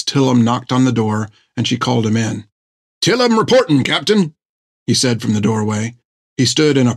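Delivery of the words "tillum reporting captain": high, stiff, at-attention voice; eager sailor voice. Acted. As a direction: report like a keen recruit.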